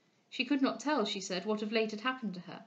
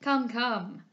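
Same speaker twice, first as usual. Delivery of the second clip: louder, mildly scolding call; brisk cry; 5 dB louder than usual. A narrator's reading. Doctor stops the excited visitor, crying out. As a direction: break in with mild reproof. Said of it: "cried Doctor Lister".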